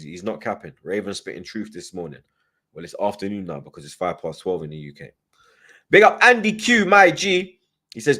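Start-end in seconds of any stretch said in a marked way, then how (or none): 5.9-7.6 higher, louder hype call to a viewer; big it up like you're calling across the room; hyped shout-out. Real, not acted. Shout-out to a chat member.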